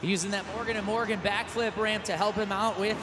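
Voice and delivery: usual speaking voice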